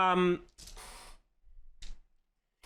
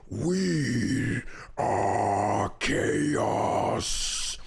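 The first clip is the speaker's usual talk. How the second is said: very slow, deep whispered menace; deep whispering god; 7 semitones lower, much slower than usual. Acted. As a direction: whisper like an ancient chaos god. GM voices the boss.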